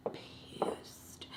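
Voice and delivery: drawn-out whisper for effect; whispered emphasis. Real, not acted. Telling viewers how mad mom was, emphatic whisper. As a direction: hush the word right down and stretch it.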